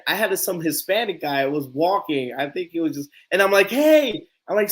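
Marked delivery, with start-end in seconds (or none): 3.7-4.3 high, loud call to a passerby. Retold or imitated. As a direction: hail a stranger from across the way. Calls out to passing stranger.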